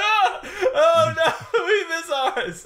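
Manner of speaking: high, loud, slow hammed-up wail with laughter; mock tearful. Retelling how she and her mom reacted at the movie, mock tearful wail. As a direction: laugh through a mock sob. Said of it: "And we're just like".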